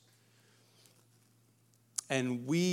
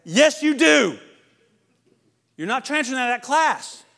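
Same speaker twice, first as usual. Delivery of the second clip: firm parent; 10 semitones higher, 14 dB louder than usual. Retold or imitated. Parent firmly refuses to transfer.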